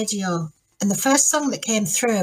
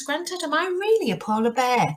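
higher-pitched, pleading polar bear cub; questioning young bear; 5 semitones higher than usual. Acted. Young polar bear asking his granddad, doubtful questioning. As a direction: ask it up high, leaning on "really". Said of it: "goes to his, uh, granddad and he says".

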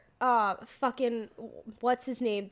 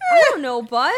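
loud, lightly voiced talking dog; hint of a brash dog character, hit louder; Underdog; 8 dB louder, faster than usual. Friend answers as the movie's dog, lightly put-on brash voice. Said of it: "that voice he just made for Underdog".

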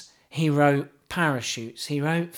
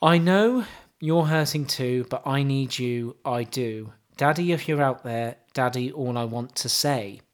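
mock poetry recital; 4 semitones lower than usual. Host reciting pop lyrics to co-host, mock solemn. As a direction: recite it like deep poetry, deadpan.

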